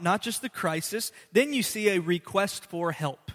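ordinary talk, nothing marked

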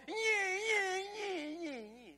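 high whining toy noise running down; squealing whirr that sinks and fades; wind-up toy whirr. Retold or imitated. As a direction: imitate a wind-up toy losing power. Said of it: "and then they'll go".

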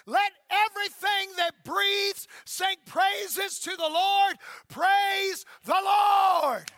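loud, slow proclaimed scripture, stretched out at the end; preaching scripture. Acted. Pastor leads church in Psalm, booming proclamation. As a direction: call it out to the whole house.

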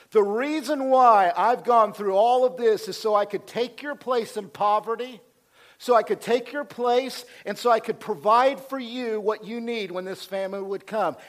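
loud, driving declaration; fervent preaching. Acted. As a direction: preach it out at full force. Preacher voices Joseph's meaning.

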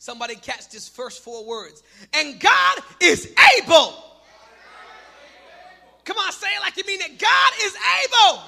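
loud, rising preacher's cry, building; full-force preaching. Acted. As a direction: preach it to the rafters, climbing.

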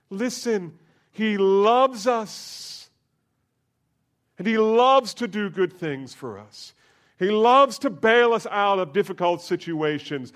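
loud, high, soaring preacher's voice; full force preaching. Acted. Pastor proclaiming God's love to his congregation, loud and soaring. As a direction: preach it out to the whole church.

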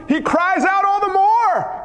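high, stretched, rising preacher's cry. Acted. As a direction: let the line itself become a cry. Preacher enacts the growing cry.

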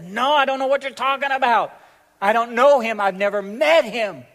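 loud, high, emphatic outburst; heated denial. Acted. Peter denying to accusers that he knows Jesus, loud and heated. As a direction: burst out in vehement protest.

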